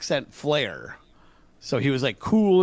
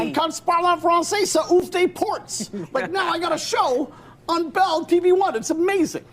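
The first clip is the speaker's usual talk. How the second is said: much higher, excited selling voice; hyped pitch; 12 semitones higher than usual. Real, not acted. On morning TV to Rosie, a high excited pitch. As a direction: sell French like a game-show host.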